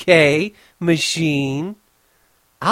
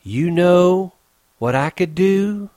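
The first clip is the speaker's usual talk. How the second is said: higher, louder menacing taunt; 9 semitones higher, 4 dB louder, slower than usual. Acted. Curly's wife closes on Crooks, menacing. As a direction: press the threat home. She closes on him threatening.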